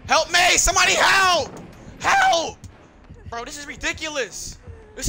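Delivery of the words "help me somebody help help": high, loud cries for help; screaming for help. Real, not acted. Gamer being chased, yelling to teammates for help. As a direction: scream for your team to save you.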